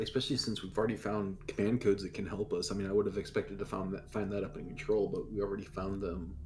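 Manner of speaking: usual speaking voice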